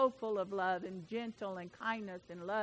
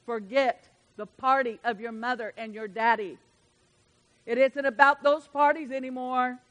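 loud pulpit preaching; fiery preacher call; preaching at full force; 2 semitones higher, 9 dB louder than usual. Acted. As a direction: hammer it home to the congregation.

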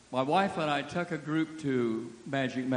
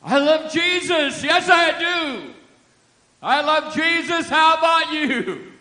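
booming, slow and much higher; a loud slow chant, rising in pitch; loud proclamation; 11 semitones higher, 12 dB louder, slower than usual. Retold or imitated. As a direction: call it out over the crowd. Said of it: "louder and louder".

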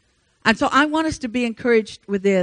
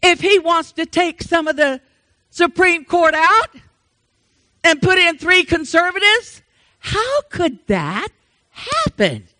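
rising preaching fervor; 6 semitones higher, 3 dB louder than usual. Acted. Preaching build.

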